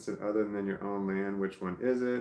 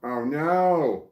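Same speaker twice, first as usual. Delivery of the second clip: startled cry; 5 semitones higher, 8 dB louder than usual. Real, not acted. Realizes scrolling mistake.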